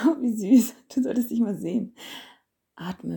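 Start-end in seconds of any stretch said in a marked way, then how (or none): 0.1-2.0 high, loud gushing coo; delighted cooing. Acted. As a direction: gush over how cute he is. Cooing at the listener.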